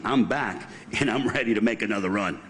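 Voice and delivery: usual speaking voice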